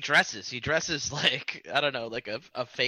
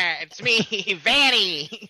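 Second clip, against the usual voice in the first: braying, put-on Van voice; [name] impression; 5 semitones higher, 5 dB louder, slower than usual. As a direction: bray the words like a goat, put on. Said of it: "That's how he talks".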